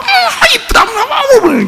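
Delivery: mock doting father, squeaky and fond; doting dad cooing. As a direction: coo over the little girl in a high voice. Dad cooing over daughter.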